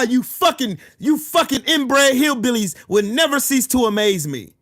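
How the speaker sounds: usual speaking voice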